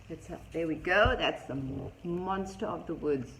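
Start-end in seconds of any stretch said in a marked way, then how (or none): none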